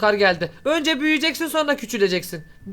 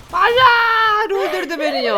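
dismayed shout; 11 semitones higher, 5 dB louder, slower than usual. Real, not acted. Killed in game.

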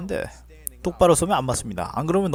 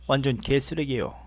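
normal talking voice

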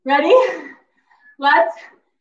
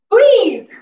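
higher, louder coaching call; loud gym-coach squeeze; urging shout; 7 semitones higher, 6 dB louder than usual. Real, not acted. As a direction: push them through the last rep. Coach urging during kickbacks.

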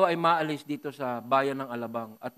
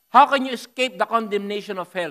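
preached warning; 6 semitones higher, 11 dB louder than usual. Acted. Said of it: "sabi ni Lord".

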